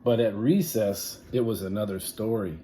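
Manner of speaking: plain reading voice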